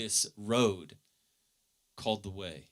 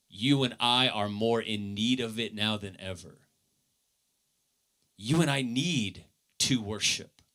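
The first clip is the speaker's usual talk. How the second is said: usual speaking voice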